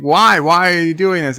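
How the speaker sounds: exasperated mock protest. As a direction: act out a baffled, pleading why. Acted exasperated question in retold argument.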